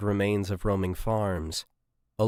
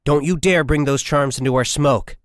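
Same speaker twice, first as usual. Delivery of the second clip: louder and higher, furious at the candy; angry shout; 5 semitones higher, 5 dB louder than usual. Acted. Corporal snaps at a private bringing candy into the vehicle, angry shout. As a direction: shout her down for breaking a superstition. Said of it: "shouted Ito".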